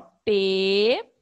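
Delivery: peteca said in three slow separate beats, pe-te-ca; teacher showing syllables of peteca; split into syllables. Shown as a demonstration. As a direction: tap out each syllable of peteca. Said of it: "vocês vão perceber que tem três".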